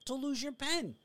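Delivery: polite menace exploding into a shout; Joe Pesci pen scene. Retold or imitated. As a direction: Casino pen-stabbing outburst. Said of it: "really great impersonation".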